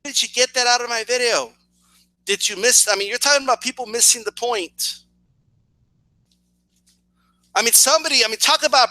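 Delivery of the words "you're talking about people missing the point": ordinary delivery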